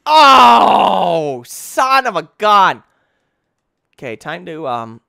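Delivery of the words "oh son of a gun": panicked screaming. Real, not acted. Fleeing monster in game.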